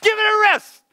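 a worn-out parent snapping; exasperated parent. Retold or imitated. Parent of small children losing patience, mock yell. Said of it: "at times you want to say".

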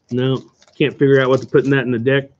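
ordinary talk, nothing marked

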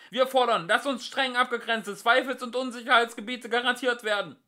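high, loud rushed demand; shouting demand. A narrator's reading. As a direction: yell it fast.

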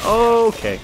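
high, loud surprised okay; startled okay. Real, not acted. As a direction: caught off guard. Startled at enemy.

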